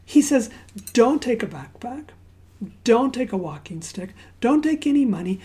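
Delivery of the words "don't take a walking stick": ordinary talk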